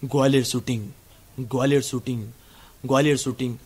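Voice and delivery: lightly mocking jingle chant. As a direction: intone it like a TV ad tagline. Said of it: "फिर से आवाज आती है".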